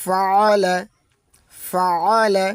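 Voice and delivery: ফাআলা, ain pressed hard from mid throat; strong ain. Shown as a demonstration. Teacher drills the ain sound. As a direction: say ফাআলা with a firm throat catch. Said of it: "শক্তভাবে উচ্চারণ করতে হয়".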